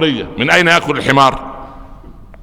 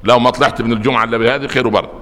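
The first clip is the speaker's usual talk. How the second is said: usual speaking voice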